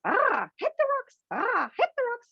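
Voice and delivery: very high squawking parrot voice. Acted. Parrot echoing Captain Miserable's words, in a high squawk. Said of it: "the parrot goes".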